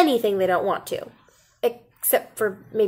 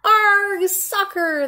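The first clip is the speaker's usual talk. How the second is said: much higher shout; crew yell; 11 semitones higher, 3 dB louder, much slower than usual. A narrator's reading. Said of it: "the crew yelled".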